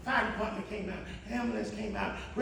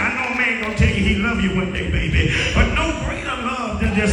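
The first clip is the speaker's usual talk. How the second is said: loud, rising sermon cadence; preaching; 11 dB louder than usual. Acted. Preacher to congregation, full-force preaching.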